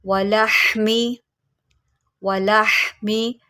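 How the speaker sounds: वलहमी with the ह breathed from mid-throat; throaty ha. Shown as a demonstration. Teacher showing students the throat letter, slow recitation. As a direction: draw out वलहमी slowly, ह from the throat. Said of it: "हा को आप निकालेंगे हलक के दरमियान से।".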